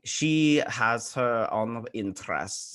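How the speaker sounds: slow mock French accent; put-on French accent, unhurried. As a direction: draw it out in an imitated French accent. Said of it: "they all talk with a French accent".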